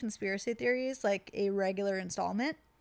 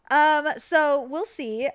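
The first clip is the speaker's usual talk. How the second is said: ordinary talk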